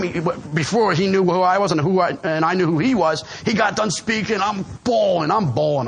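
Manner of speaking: ordinary delivery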